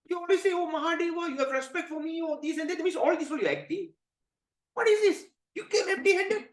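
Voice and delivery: very high-pitched acted indignation; angry outburst. Shiva accusing Narada of only acting, outraged. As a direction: rant in scandalized, squeaking outrage. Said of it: "Lord Shiva, you're very angry".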